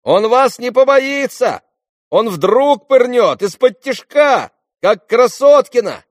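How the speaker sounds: high, slow, loud yelling; warning shouts. A narrator's reading. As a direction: yell warnings after him from afar. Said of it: "кричали ему вслед предупредительно". (громкие крики вслед)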